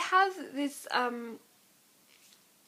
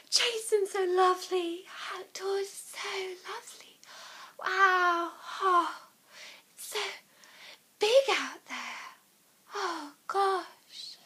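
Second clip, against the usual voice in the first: much higher, airy and weird; a dreamy, much higher airy voice; Riss voice; 8 semitones higher, slower than usual. Retold or imitated. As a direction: float along dreamily in awe. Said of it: "Riss voice".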